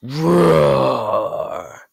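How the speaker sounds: long monster roar; Bowser. Acted. Bowser announces himself, monster roar. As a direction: let out a drawn-out beast roar. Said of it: "If you don't know where that roar's from".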